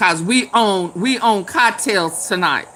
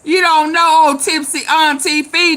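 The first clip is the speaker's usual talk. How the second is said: ordinary talk, nothing marked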